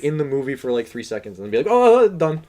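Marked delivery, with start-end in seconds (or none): 1.6-2.4 mocking dismissive voice. Mocking the filmmakers' quick backstory.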